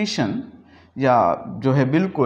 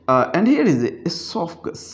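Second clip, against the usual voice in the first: usual speaking voice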